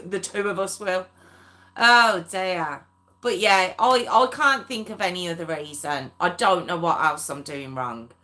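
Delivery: ordinary talk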